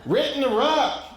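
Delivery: high-pitched, mush-mouthed holler; toothless aunt yelling. Retold or imitated. Aunt yelling at Uncle Earl, toothless holler. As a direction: holler as if you had no teeth in. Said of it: "didn't have her teeth in ... yelling".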